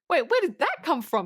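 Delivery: high-pitched, baffled protest; shocked disbelief. Retold or imitated. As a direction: voice leaps up in disbelief. Viewer's shock at the line.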